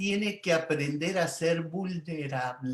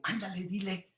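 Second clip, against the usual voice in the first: hushed, softer nudge to the father; soft urging; 2 semitones higher, 8 dB quieter than usual. Retold or imitated. Coaxing the father to speak to his son, hushed. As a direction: quietly nudge him to say it.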